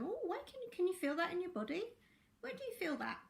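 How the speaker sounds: put-on high, soft voice for a child; light, high, coaxing question; gentle asking. Parent gently questioning a happy child.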